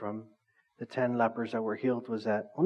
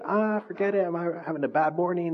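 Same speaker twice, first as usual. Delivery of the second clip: mock exasperation; 6 semitones higher than usual. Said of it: "the old me would have said".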